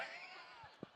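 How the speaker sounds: short airy chuckle; breathy laugh. Real, not acted. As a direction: laugh at the joke. Laughs at own story.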